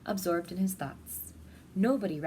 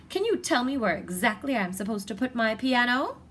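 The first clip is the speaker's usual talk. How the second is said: indignant lady complaining; 4 semitones higher, 6 dB louder than usual. Acted. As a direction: pile the complaints up until she bursts. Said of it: "says a lady".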